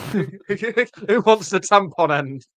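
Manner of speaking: slow teasing call; slow mock offer. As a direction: drag it out like a game show. Voicing lads, joking.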